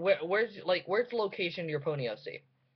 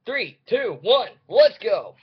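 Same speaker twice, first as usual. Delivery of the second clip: builds to a big loud slow "let's go"; excited countdown; 5 semitones higher, 10 dB louder, slower than usual. Real, not acted. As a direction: count down and hype yourself up, loud. Countdown to start the reading.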